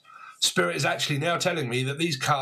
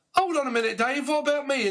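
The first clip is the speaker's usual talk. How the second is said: much higher, mock-indignant protest; ignored partner; 12 semitones higher than usual. Partner feeling ignored calls out Dave, high mock-indignant voice. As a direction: play the neglected partner, pitched way up and put out. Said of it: "And the partner is like".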